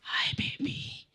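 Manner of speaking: put-on breathy whispered girlfriend voice; man doing a woman's voice. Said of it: "बात ऐसे ही करता था मैं जैसे".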